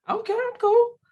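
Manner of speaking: loud, high-pitched feigned coolness; mock nonchalance. Joking about early days with him, high mock indifference. Imitates nonchalance after laughing.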